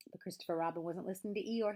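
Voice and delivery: plain reading voice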